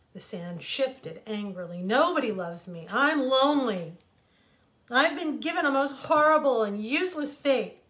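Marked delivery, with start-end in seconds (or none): 1.8-7.9 louder, higher, a self-pitying protest; loud complaint. A narrator's reading. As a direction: complain bitterly and loudly. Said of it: "the desert complained".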